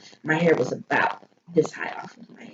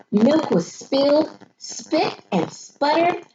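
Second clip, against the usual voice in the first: ordinary talk, nothing marked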